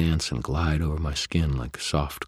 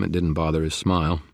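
plain reading voice

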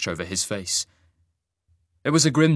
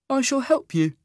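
sing-song voice. A narrator's reading. Isaac's voice.

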